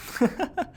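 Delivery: short amused chuckle. Real, not acted. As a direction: laugh briefly at your own joke. Laughing at own joke.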